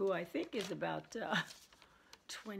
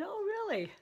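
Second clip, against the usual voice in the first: surprised oh really; 12 semitones higher than usual. Retold or imitated. Mom reacts to DNA results.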